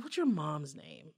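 low, slow, quiet question; cool confrontation. Acted. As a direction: ask it with controlled menace. First asking, confronting the man.

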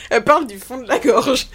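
laughing. Real, not acted. Laughing fit.

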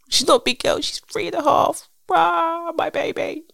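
high, cooing mum voice. Real, not acted. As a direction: gush over your little one. Doting mum over her toddler.

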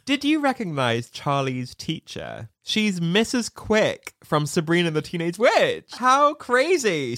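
ordinary talk, nothing marked

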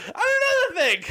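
very high-pitched ranting impression; comic high-voiced outburst; mock rant. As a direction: launch into a squealed mock tirade. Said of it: "He just starts fucking ranting".

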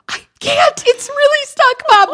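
high, panicky plea to mom; stuck in the bathroom, pleading with panic. Retold or imitated. Teen daughter begging mom through the door, high and panicky. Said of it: "Like, panicky".

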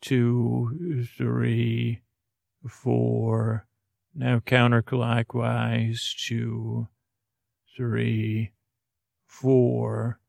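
slow, soft relaxation guidance; soothing sleep-coach voice; guided relaxation. Acted. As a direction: count them gently through each turn, unhurried. Guides a slow jaw relaxation exercise.

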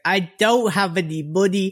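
high-pitched, put-on pleading; tearful begging woman. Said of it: "kept crying about her child".

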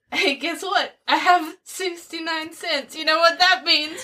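meme voice. Retold or imitated. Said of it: "it's like".

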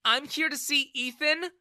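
high, loud, pointed reply; angry. A narrator's reading. Daughter defying her father, angry. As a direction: snap it back at him. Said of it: "she replied angrily".

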